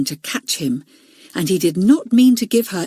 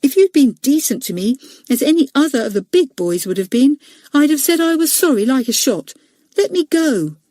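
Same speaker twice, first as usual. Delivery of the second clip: put-on higher, a boy protesting hotly; indignant small boy; 6 semitones higher than usual. Leslie to Robert, high indignant boy voice. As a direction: plead and scold at once, small and high.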